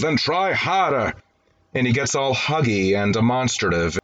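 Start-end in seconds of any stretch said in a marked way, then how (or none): 0.0-1.1 mock paternal outburst. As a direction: act out an exploding father, mildly. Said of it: "And the father explodes".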